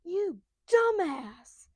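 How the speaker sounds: loud, high, drawn-out exasperated yell; Shanks. Retold or imitated. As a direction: stretch the insult into a cartoon scolding. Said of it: "And then Shanks is like".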